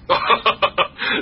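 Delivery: short humourless laugh; bitter laugh. Acted. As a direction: laugh at the bad luck. Brother laughs bitterly at situation.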